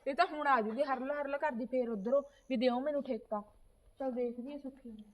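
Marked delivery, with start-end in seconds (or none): 4.0-5.0 quiet aside. Acted. Niece says aside to herself.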